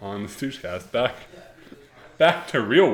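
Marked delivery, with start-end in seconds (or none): 0.0-0.9 slow, stretched show announcer. Acted. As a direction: drag it out like a radio host. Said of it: "host relaunching the show".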